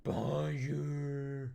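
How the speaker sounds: a distant little monster call, stretched out; green monster greeting. Retold or imitated. Echoing the commercial's monster to himself, softly drawn out. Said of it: "Just a green monster goes".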